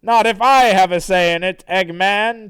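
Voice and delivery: cartoon hero cutting in boldly; Sonic. Sonic defies Eggman, loud and bold. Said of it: "And then Sonic says".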